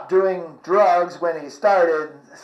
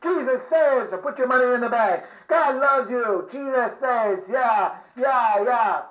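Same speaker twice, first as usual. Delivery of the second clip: higher, fervent street preacher call; 5 semitones higher, 4 dB quieter than usual. Retold or imitated. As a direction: preach to the passing crowd, pitched up. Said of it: "street preaching".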